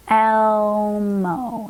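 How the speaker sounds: "Elmo" drawn out sound by sound; stretched out. Shown as a demonstration. Teacher thinks through removing a sound, stretched out. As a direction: hold the "El" long, then glide into "mo".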